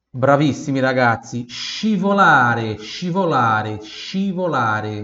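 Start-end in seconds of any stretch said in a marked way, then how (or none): 1.5-5.0 scivolare said slowly, shi-vo-LA-re; slow Italian teacher model. Shown as a demonstration. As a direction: drag scivolare out for repeating. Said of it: "Repita em voz alta".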